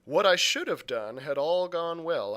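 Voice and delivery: ordinary delivery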